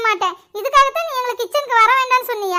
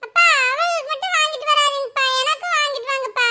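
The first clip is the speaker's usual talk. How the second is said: put-on whiny child; 2 semitones higher than usual. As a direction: wheedle father for parotta too. Son begs father for parotta.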